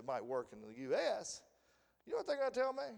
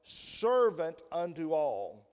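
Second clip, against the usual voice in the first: scripture reading; 6 dB louder than usual. Acted. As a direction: read the verses out solemnly. Reads scripture aloud.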